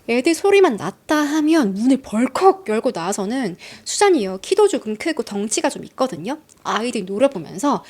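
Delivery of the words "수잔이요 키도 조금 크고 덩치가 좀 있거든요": ordinary talk, nothing marked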